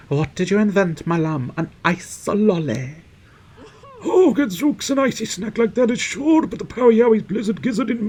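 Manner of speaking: high-pitched, hammed-up delight; gushing game character. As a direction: gush theatrically in a high doting voice.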